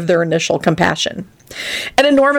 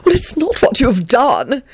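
huffy; 9 semitones higher than usual. A narrator's reading. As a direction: huff it with climbing pitch. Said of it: "the man huffed in response".

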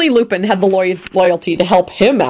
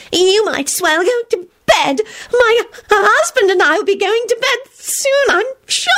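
peevish whine. A narrator's reading. Loretta continuing peevish whine.

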